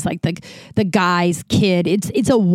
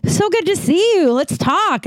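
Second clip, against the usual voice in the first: higher, eager chatter; gushing excitement; 10 semitones higher than usual. Retold or imitated. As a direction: play it as an overjoyed friend pulling you aside. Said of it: "He goes".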